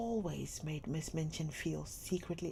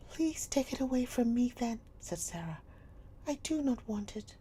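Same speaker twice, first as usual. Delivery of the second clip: put-on high young girl, proud; girl's voice; 7 semitones higher than usual. A narrator's reading. Sarah to Miss Minchin, high and proud. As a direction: offer it up with dignity.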